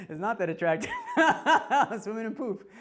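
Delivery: loud, high voice cracking up; helpless real laughter while talking; laughing. Real, not acted. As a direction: squeeze the words out through laughter. Laughing at his own embarrassment.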